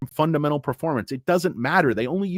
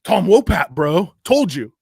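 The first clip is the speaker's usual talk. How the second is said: louder mock brag; mock boast; 2 semitones higher, 7 dB louder than usual. Streamer voicing a friend boasting about Tom Wopat, louder. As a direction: crow it like a bragging buddy. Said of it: "He's like".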